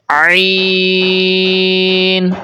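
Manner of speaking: عین stretched very long from a squeezed throat; drawn-out Arabic عین, opening in mid-throat; mid-throat ayn. Shown as a demonstration. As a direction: recite عین on a held steady note. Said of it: "حلق کے درمیان سے ادا کرنا".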